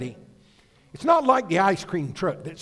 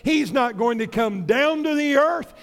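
usual speaking voice

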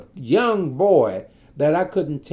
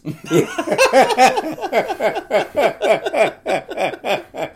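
long rolling laugh; laughter; 10 semitones higher, 3 dB louder than usual. Real, not acted.

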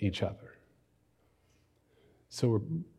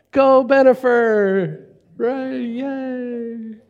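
much higher, loud, squealing fangirl cheer; mock fan cheer; 13 semitones higher, 6 dB louder, much slower than usual. Preacher mocks the crowd cheering a celebrity couple in high squeals. Mocking celebrity-obsessed fans.

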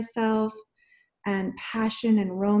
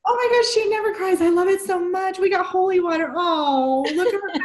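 high, excited gushing; delighted gush; 9 semitones higher than usual. Real, not acted. Host delighted that Janet is crying. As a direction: gush over her tearful friend.

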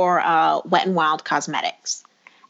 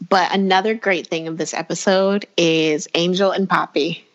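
normal talking voice

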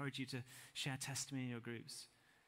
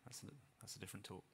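quick aside; 4 semitones lower, 10 dB quieter, faster than usual. Real, not acted.

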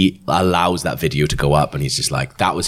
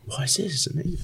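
delighted gasp; 12 semitones higher, 8 dB quieter than usual. Pretend. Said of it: "she'd be like".

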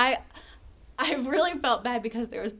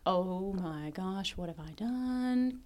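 retold dread; 3 semitones lower, 7 dB quieter, much slower than usual. Retold waking up late in dread.

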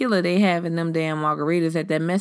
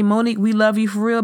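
usual speaking voice

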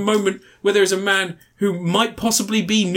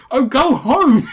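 dismissive shout; 8 semitones higher, 5 dB louder, slower than usual. Retold or imitated. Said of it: "and she just goes".

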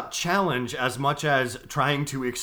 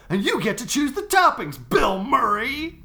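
wrestling promo; 9 semitones higher, 5 dB louder than usual. Pretend. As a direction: play the trash-talking wrestler. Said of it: "we just cut a wrestling promo".

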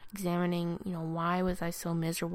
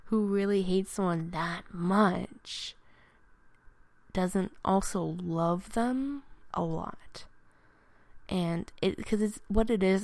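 ordinary delivery

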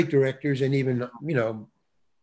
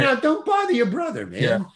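lightly acted grandfather, pitched much higher; grandfather scolding; 8 semitones higher than usual. Grandfather tells sister to leave brother alone, mock scolding. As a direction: pretend to chide a grandchild in a raised pitch. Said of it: "my grandfather telling something to my sister".

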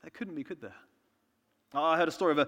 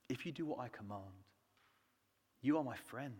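tender voice of Jesus; 4 semitones lower, 3 dB quieter than usual. Retold or imitated. Jesus speaking to the congregation, softly and tenderly. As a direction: speak it like a promise to a friend.

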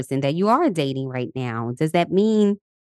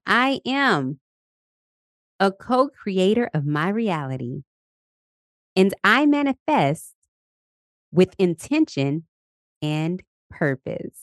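slow, deliberate affirmation with long pauses; spoken affirmation for listeners to repeat, slow and deliberate; 3 semitones higher, slower than usual. Shown as a demonstration. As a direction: lead the listeners through each phrase, slowly. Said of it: "Let's repeat the affirmation".

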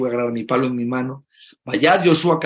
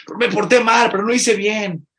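put-on higher, louder anxious child voice; child pleading; 8 semitones higher, 5 dB louder than usual. Child anxiously tells his father he misbehaved. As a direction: plead like a guilty little boy.

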